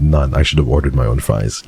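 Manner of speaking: lightly voiced suave rogue; Silas voice. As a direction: purr it like a smug charmer. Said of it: "I'm doing the voice".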